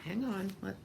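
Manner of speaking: plain reading voice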